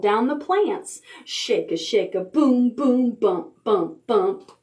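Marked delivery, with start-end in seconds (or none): none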